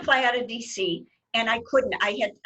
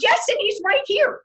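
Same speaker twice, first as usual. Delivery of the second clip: high, louder thrilled announcement; excited; 12 semitones higher, 6 dB louder than usual. Retold or imitated. Fan telling friends on the phone, excited and high. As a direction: squeal out the news. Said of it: "I was so excited".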